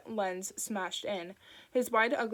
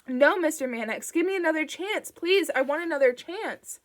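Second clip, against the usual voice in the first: high, loud, desperate begging; panicked plea; pleading; 8 semitones higher, 7 dB louder than usual. A narrator's reading. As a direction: beg for one more chance. Bing begs for another chance.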